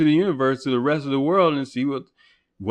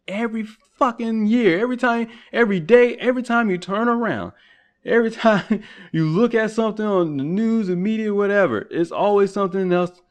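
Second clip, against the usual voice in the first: worked-up rant; 8 semitones higher than usual. Real, not acted. Building rant about media fear.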